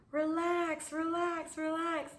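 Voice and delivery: high, soft soothing voice, repeated calmly; an instructor soothing a scared flyer. Retold or imitated. Paraglide instructor calming her: high, soft soothing. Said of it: "she's like".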